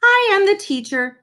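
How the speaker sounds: bossy make-believe teacher announcing herself; a hint of a child imitating her teacher; pretend teacher. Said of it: "Lily pretended to be Mister Slinger".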